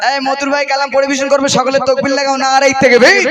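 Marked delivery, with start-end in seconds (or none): 2.4-3.2 loud rallying cry rising at the end; loud, rising takbir call; slogan call. Real, not acted. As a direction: shout it for the crowd to answer. Leads the crowd in takbir slogan.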